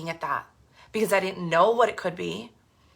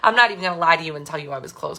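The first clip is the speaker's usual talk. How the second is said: ordinary talk, nothing marked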